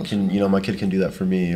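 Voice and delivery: normal talking voice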